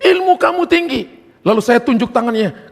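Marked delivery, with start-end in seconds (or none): 0.0-1.2 lightly voiced shaman, pitched high and slow; high, drawn-out quote; shaman's challenge. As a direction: imitate a shaman addressing a rival. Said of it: "itu dukunnya bilang nih".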